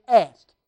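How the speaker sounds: "Asked" hammered out loud; "Asked" as one loud, emphatic word; stress on Asked. Shown as a demonstration. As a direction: drive "Asked" home with a burst of volume. Exactly what the scripture says.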